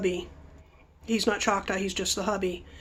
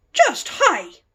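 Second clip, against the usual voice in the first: high, curt voice for the hostess; imitating the hostess; 7 semitones higher, 3 dB louder than usual. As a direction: mimic her short, cool reply. Mama-san's curt reply.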